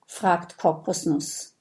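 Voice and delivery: ordinary delivery, nothing marked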